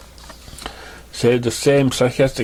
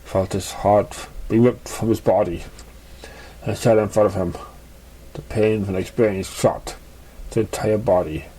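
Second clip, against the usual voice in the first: plain reading voice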